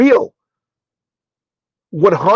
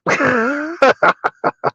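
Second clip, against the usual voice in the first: laugh; 9 semitones higher, 3 dB quieter than usual. Real, not acted. Laughs after saying oops.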